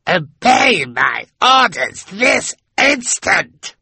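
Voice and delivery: villain's furious scream; screaming Olaf. A narrator's reading. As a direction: scream the order in fury. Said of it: "he screamed".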